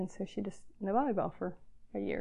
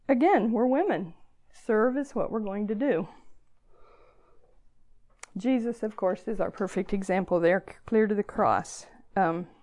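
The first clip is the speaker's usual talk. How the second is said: ordinary talk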